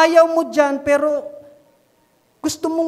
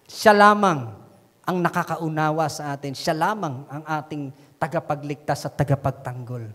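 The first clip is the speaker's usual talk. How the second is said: normal talking voice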